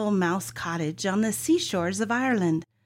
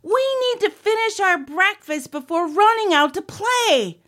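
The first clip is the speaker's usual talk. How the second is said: high, loud storybook yell; 10 semitones higher, 7 dB louder than usual. A narrator's reading. Olivia leaning over the table to Oliver, yelling. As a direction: yell it at your brother in a high voice. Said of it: "yelled to Oliver".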